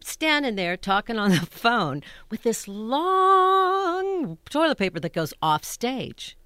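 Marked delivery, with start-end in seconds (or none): 2.7-4.4 LONG stretched out high and loud; drawn-out word. Real, not acted. Stretching the toilet paper tale, LONG held high and loud. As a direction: hold LONG far past its natural length.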